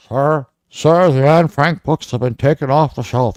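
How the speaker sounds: lightly voiced whiny host; mimicking the host. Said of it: "he said".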